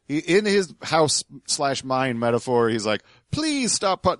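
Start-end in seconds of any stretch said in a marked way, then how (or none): 3.3-4.2 high-pitched imitation of polite begging; mock polite plea. Host mocks the man begging in his mine metaphor, politely pleading. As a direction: mimic a feebly courteous plea, pitched way up. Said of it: "he thinks he's doing this politely".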